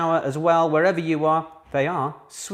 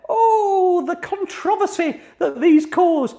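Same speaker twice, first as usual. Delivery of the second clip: high, loud, drawn-out mock lament; mock outrage; 6 dB louder, much slower than usual. Host bemoans the fuss over quiz questions, in mock despair. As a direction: wail it slowly with exaggerated drama.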